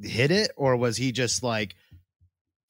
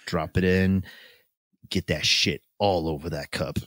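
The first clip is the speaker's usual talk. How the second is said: sultry voice; 5 semitones lower than usual. Pretend. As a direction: purr it low and seductive. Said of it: "Should we do sultry voice for this?".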